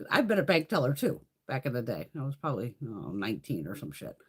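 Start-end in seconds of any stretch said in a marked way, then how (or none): none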